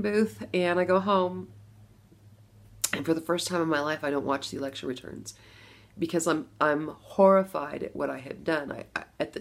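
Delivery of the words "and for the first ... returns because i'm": ordinary talk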